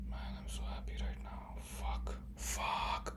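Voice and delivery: whispered to himself; whispering in disbelief; excited whisper. Real, not acted. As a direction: breathe the words out without voice.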